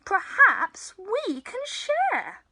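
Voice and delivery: loud, lightly voiced friendly animal; grinning Badger. A narrator's reading. Badger offers to share honey nuts, loud and grinning. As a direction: offer it with a big audible grin. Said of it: "Badger says with a grin".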